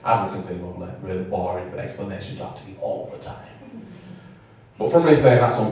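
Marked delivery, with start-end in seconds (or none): none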